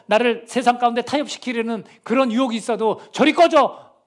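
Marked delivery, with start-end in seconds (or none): 3.1-3.9 high, forceful burst of dismissal; defiant rebuke. Acted. Believer tells temptation to get lost, defiant rebuke. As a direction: drive it away with a sudden raised voice.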